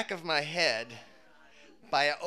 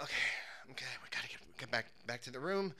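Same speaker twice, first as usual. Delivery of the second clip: half-whispered urgent note to self; hushed self-talk; 4 semitones lower, 7 dB quieter, much faster than usual. Retold or imitated. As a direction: mutter it low and under the breath. Hushed self-talk while sneaking back.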